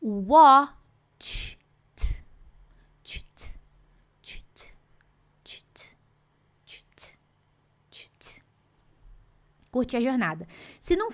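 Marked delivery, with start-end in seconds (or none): 0.0-1.6 Watched said as "wotcht", tch then t; English watched. Shown as a demonstration. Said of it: "Então, olha só". 3.0-8.4 whispered drill. Shown as a demonstration. Teacher drills ending sound whispered.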